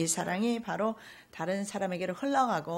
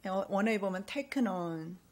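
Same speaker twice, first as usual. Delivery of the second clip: normal talking voice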